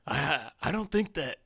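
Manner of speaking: high-pitched, hammed-up sitcom neighbor impression; Kramer. Co-host objects to the Brits in character, Kramer voice. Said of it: "I'm breaking out the Kramer".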